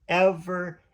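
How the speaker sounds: a high, distant echo of the word ever. Acted. Host echoes ever as a radio effect, high and distant. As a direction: let "ever" ring out high and far off like an echo.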